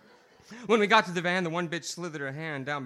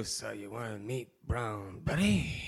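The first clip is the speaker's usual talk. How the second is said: lower, slow come-on; low sultry woman; 8 semitones lower, 5 dB quieter, slower than usual. Acted. Woman in the bar luring the listener, low and slow. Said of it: "barks at you".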